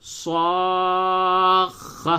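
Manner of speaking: صاخ drawn out very slowly, kha held long; doubled kha. Shown as a demonstration. Teacher models the doubled kha very slowly. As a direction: stretch صاخ and hold the final kha. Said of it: "اب ملا کر کے جب بولیں تو اس طرح".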